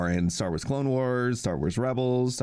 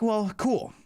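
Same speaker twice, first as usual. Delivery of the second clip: delighted reply; 13 semitones higher than usual. Retold or imitated. Happy reply to agent's call.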